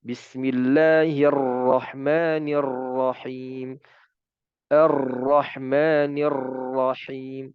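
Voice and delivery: slow, every r in "Ar-Rahmanirrahim" trilled far too long; mock over-trilled Quran reading; overdone rolled r. Shown as a demonstration. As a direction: show the wrong, exaggerated r trill. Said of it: "Ini kebanyakan".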